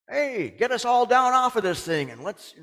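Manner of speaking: loud, high mocking demand; criminal's demand. Criminal on the cross, shouting to be freed, loud and high.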